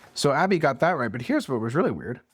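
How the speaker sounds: usual speaking voice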